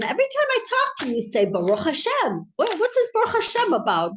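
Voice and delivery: high, rapid exasperated scolding; imitated exasperated boss; upset landowner. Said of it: "the Paritz is upset at him".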